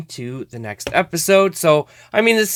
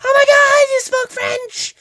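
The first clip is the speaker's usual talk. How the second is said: very high squealing girlfriend impression; imitating girlfriend; 4 dB louder than usual. Mocking how his girlfriend will react, high squeal. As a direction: gush like an excited girlfriend. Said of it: "she's like".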